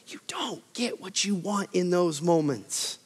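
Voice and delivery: hushed, intimate aside to the congregation; whisper. Real, not acted. Preacher whispering to the congregation. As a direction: drop to a whisper for the point. Sermon point dropped to whisper.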